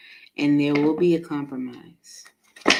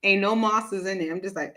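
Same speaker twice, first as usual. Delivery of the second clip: ordinary delivery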